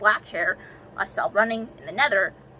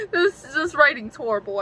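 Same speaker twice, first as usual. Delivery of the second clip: higher, cry-laugh through the words; laughing in embarrassment; 7 semitones higher than usual. Real, not acted. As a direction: laugh helplessly at your own writing. Cringing at childhood writing.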